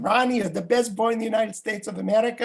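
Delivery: put-on high, rapid Russian-accented grandpa. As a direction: rattle off doting praise in a Russian accent. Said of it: "say to me in his Russian accent".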